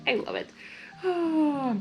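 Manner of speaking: plain reading voice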